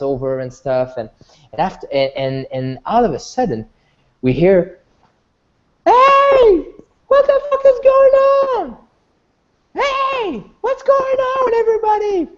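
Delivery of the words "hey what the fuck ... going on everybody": high-pitched confused yell; girl yelling. Acted. Girl suddenly heard over the dancing, high alarmed yell. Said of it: "then there's a girl".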